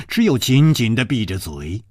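usual speaking voice

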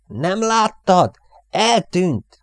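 high, loud, stretched-out question; panicked yell. A narrator's reading. Airman begs crewmate about the lost medal, yelling. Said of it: "ordított".

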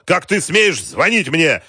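loud, high outraged questions; indignant shouting. A narrator's reading. General rebuking Wolf for calling, shouting. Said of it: "крикнул генерал".

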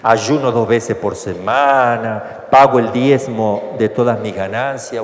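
slow, proud list of good deeds; slow self-praise; slow boasting. Retold or imitated. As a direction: lay out your merits slowly. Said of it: "oraba así en su interior".

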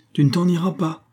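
high sharp cry; exclaimed. A narrator's reading. Mein grabbing the stranger's arm, crying out high. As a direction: stop him with a sudden high cry. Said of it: "s'écria-t-il".

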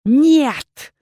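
high, loud hoarse refusal. A narrator's reading. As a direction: cry out a raw no. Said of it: "хрипло произнесла женщина".

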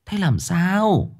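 put-on old woman startled asking what happened. A narrator's reading. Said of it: "bà thảng thốt".

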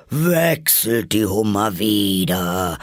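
drawn-out creature cry; mild howl. Acted. Griffin calling out dance steps, mildly howled. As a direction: raise the voice a little and howl the words. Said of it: "heulte der Greif mit erhobener Stimme".

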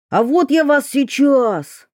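high, threatening cry; rising to the attack. A narrator's reading. Mikitka threatens the forest lights.

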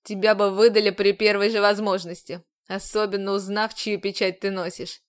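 a commander bearing down on her; stern commander. A narrator's reading. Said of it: "заверил командор".

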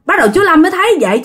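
ordinary delivery, nothing marked

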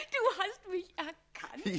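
bursts out laughing, then asks through the laugh; laughing delighted disbelief. Acted. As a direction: giggle the question out high. Caught in disguise, laughs.

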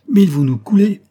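high, loud cry of alarm; alarmed exclamation. A narrator's reading. Elim realises the fleet may sink them, alarmed. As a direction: burst out in alarm, pitched right up. Alarm under cannon fire.

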